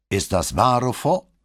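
loud question, rising high on the name; commander's pointed question, lifted at the end; demanding inquiry. A narrator's reading. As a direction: check up on the cadet sharply. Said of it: "erkundigte sich der Kommandante".

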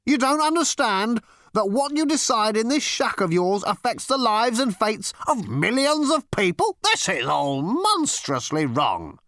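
high, sustained tirade, slowing on the last words; exasperated, righteous outburst; outraged interrogator. A narrator's reading.

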